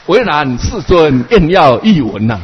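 slow, loud chanted answer; sutra-style reply. Acted. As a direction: intone it like scripture. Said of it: "我说".

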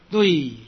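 瑞 with its vowel drawn out long; slow, lengthened 瑞. Shown as a demonstration. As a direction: hold the final vowel of 瑞. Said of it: "一横的代表长音".

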